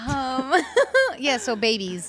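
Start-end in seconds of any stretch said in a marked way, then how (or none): 0.6-1.1 short high-pitched giggle. Real, not acted. Teasing a friend about babies, a high giggle. Laughing at own question.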